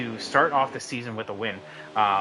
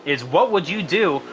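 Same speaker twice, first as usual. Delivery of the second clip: raised, energetic finish call; race commentary; 5 semitones higher than usual. Acted. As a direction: build the hype to the win.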